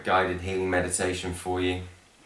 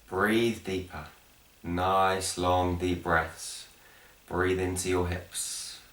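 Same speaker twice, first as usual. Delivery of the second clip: guided meditation; 3 dB quieter, slower than usual. Acted. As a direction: guide them gently down into the breath, unhurried. Leads guided breathing meditation.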